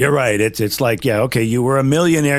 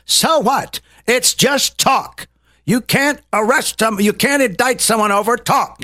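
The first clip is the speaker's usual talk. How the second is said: heated rant; 7 semitones higher than usual. Retold or imitated. Quoting a radio host dismissing the charges, heated. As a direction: imitate a pundit getting worked up. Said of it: "he gets all in it".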